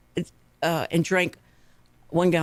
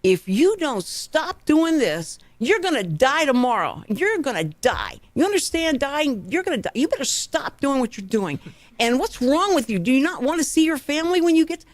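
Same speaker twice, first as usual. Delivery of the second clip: imitating an old-time scolding doctor, pitched up; mock stern lecture to scare a patient; fear-tactic scolding; 7 semitones higher than usual. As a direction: hammer out dire warnings, higher and emphatic. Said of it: "Like fear tactics".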